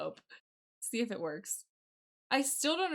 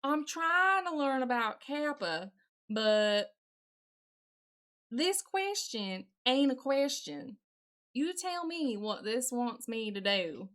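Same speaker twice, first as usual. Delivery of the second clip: drawling, put-on Southern accent. Streamer asking about a confusing question, put-on Southern accent. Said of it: "This is a terrible, terrible Southern accent".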